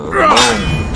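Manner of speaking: loud triumphant boom; excited shout. Real, not acted. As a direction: shout it out in victory. Triumphant shout after a kill.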